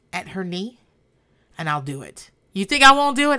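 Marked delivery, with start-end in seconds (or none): 2.5-3.4 high, loud challenge; loud dare. Acted. Daring the family to call her bluff, loud. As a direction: throw the dare at them.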